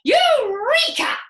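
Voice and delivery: slow, very high, very loud; father crying eureka in triumph; triumphant cry. Acted. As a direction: shout the discovery. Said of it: "cried Mister Cranky".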